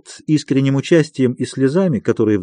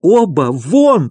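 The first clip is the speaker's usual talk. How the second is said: raised, drawn-out shout; angry shouting; 4 semitones higher, 5 dB louder, much slower than usual. A narrator's reading. Doctor still driving both visitors out, angry shouting. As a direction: keep ordering them out at the top of your voice. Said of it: "продолжал кричать Андрей Ефимович".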